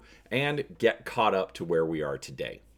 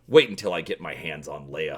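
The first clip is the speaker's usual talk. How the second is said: mild growl; 7 semitones lower than usual. Acted. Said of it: "Han growls".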